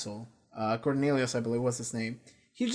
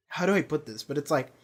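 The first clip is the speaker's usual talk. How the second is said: ordinary delivery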